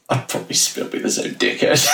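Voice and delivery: half-laughed, breathy self-mockery; laughing confession. Real, not acted. Host jokes he'd still be a dickhead, laughing. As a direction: laugh it out at yourself. Joking about his youth.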